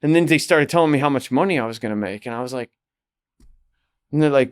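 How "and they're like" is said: ordinary talk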